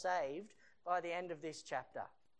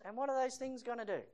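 ordinary talk

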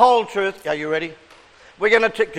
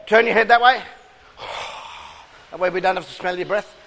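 usual speaking voice

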